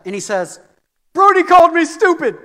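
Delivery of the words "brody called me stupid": high, loud tattling outburst; upset child. Retold or imitated. Upset son running down to tell his dad, upset. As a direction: wail it like an upset kid. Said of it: "all upset".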